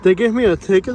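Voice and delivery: "ticket?" rising, loud and high; incredulous question. Real, not acted. Vlogger repeating the news to viewers, incredulous rise. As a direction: ask it like he can't believe it.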